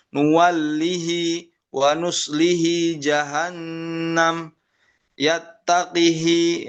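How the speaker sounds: slow, drawn-out Quranic recitation, Yu'addihi with a long kasrah "hi"; recited with kasrah on hi. Shown as a demonstration. Teacher recites examples to Quran students, slow and drawn out. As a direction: chant each word slowly, ending in a clear "hi". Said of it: "misalkan seperti itu".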